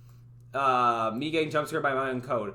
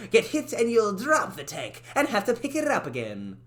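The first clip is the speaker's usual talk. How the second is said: high cartoon character voice; 3 semitones higher than usual. Game character congratulates the player, high and excited. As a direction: announce the find with delight. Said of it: "Talking like this".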